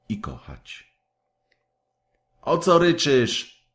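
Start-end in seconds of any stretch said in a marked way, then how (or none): 2.4-3.6 high, sharp sneering question; a rude boy snapping at a crying girl; snappish. A narrator's reading. Kaj snaps at the crying Gerda, high and rude. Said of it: "zapytał odpryskliwie".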